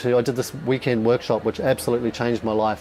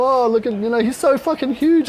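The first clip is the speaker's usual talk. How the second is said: put-on much higher and louder, excited kid gushing; mocking kids' voice; 11 semitones higher, 4 dB louder than usual. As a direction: squeal it like an amazed child. Other kids' comment mimicked.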